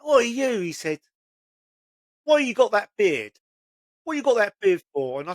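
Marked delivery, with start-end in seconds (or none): 0.1-0.6 high, loud mock challenge; imitating a pushy drunk, higher and louder; drunk bloke at the pub. Drunk man picking a pub fight. 2.2-5.2 higher, louder mocking jab; imitated drunk taunt, higher and louder; goading drunk. Drunk man goading about beard.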